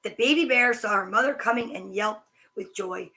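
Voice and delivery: usual speaking voice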